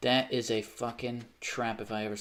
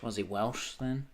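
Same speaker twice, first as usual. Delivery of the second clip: usual speaking voice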